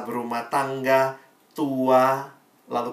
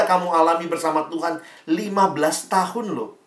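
firm challenge; 4 semitones higher, 6 dB louder than usual. Retold or imitated. Pastor confronts upset teen.

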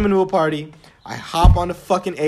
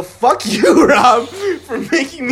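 slow, louder, laughing curse at Rob; laughing mock anger; 6 semitones higher, 5 dB louder, slower than usual. Real, not acted. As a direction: scold Rob while laughing. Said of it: "I was pretty heated about that".

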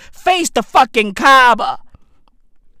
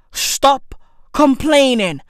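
normal talking voice

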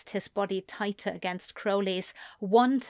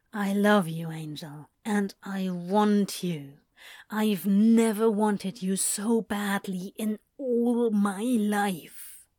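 ardent lover; slower than usual. A narrator's reading. Lover confessing while nibbling.

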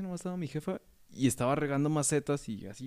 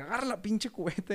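much higher mother barking an order; bossy mom; 9 semitones higher than usual. Retold or imitated. Mother ordering her son to grab the bucket, high and bossy. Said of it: "me empieza a meter un cague".

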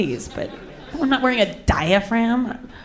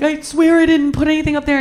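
retold frantic oath, higher pitch; pleading promise; 6 semitones higher, 4 dB louder than usual. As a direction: swear innocence, mock-desperate. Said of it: "I'm trying to promise him".

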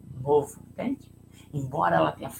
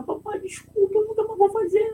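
put-on much higher, tearful little-girl plea; mock child sobbing apology; 3 dB louder than usual. Daughter apologizing to her dad, tearful high plea. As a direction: apologize through tears like a small child.

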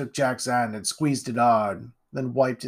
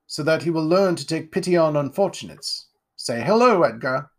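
higher, grand aristocrat voice; pompous lord; 4 semitones higher, 4 dB louder than usual. Acted. Lord Ironwood greeting Jack, pompous and grand. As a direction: puff yourself up like a self-satisfied lord. Lord introduces his son.

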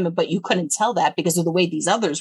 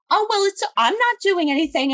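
high innocent mock voice; mimicking the line cutter; 11 semitones higher than usual. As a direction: play dumb like the woman. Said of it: "She's like".

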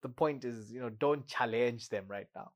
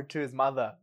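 normal talking voice

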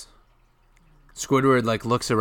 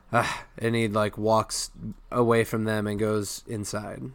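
usual speaking voice

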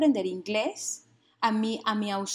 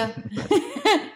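high laugh; 12 semitones higher, 4 dB louder than usual. Real, not acted. Risa al contar anécdota.

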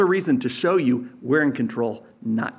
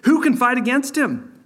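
admirers' voice; 8 semitones higher than usual. Acted. Preacher voicing the beast's admirers.

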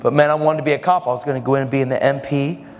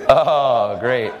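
normal talking voice

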